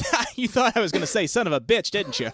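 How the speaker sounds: lightly voiced cartoon pig teasing; Porky Pig taunt. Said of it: "And he goes".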